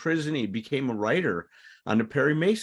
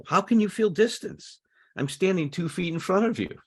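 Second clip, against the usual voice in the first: mocking retort pitched far higher and louder; sarcastic; 10 semitones higher, 3 dB louder than usual. Husband mocks his ill wife's complaint of distance, sarcastic and high. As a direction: scoff at her, incredulous. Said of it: "He says this sarcastic".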